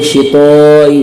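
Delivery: शितोई with a thick, rounded "to"; wrongly heavy T. Shown as a demonstration. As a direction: darken the middle of शितोई. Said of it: "मोटा कर देते हैं". (शितोई में आवाज़ मोटी, ग़लत)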